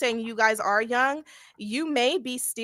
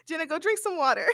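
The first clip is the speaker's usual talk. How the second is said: laughing through the words, pitch up; giggling as she gets the words out; 7 semitones higher than usual. Real, not acted. Streamer laughing at her moderators yelling in chat.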